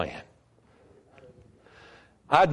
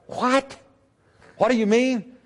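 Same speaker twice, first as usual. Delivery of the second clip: put-on surprised young man; 11 semitones higher than usual. Young man's surprised question.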